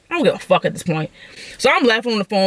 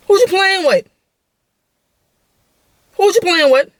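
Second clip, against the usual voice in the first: high, raised-voice challenge; confrontational challenge; 9 semitones higher, 3 dB louder than usual. Retold or imitated. Backed by cousins, challenging the other side, high confrontational call. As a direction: call them out, pitched way up.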